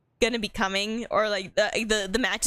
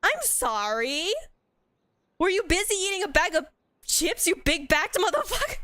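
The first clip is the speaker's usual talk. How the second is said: higher, sharp exasperated yelling at a teammate; annoyed outburst; 7 semitones higher than usual. Real, not acted. Player scolding a useless teammate, exasperated and high. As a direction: vent at the teammate who won't move.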